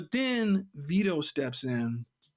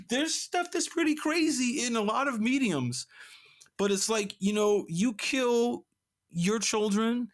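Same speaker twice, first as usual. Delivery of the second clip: pitched high in disbelief; incredulous; 6 semitones higher than usual. Real, not acted. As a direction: sound stunned at how crazy it is. Incredulous recap of shocking comic.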